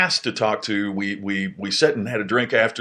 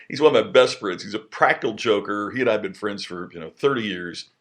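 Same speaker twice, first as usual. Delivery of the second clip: ordinary delivery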